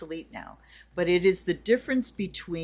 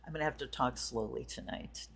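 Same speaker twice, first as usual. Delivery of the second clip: ordinary delivery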